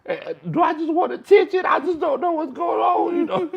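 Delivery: mock whiny self. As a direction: play your own self-pity up big. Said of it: "I be like".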